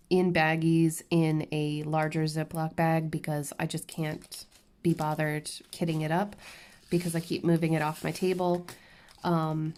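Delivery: ordinary talk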